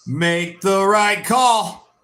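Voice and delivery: drawn out, each word stretched; slow pleading. Real, not acted. Fan begging the referee, slow and drawn out. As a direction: slowly beg the ref for the call.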